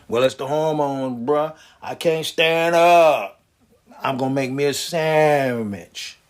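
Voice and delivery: low, slow lazy drawl; slow shrugging excuses; lazy excuse-making Larry. Acted. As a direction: slouch through the excuse. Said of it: "Larry's like".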